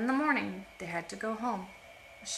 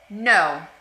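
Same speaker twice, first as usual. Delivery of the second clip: loud, flat refusal; firm no; 13 dB louder than usual. Acted. Being's sister rejecting the oak tree answer, firm loud no. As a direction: shut the lie down hard.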